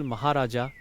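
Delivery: plain reading voice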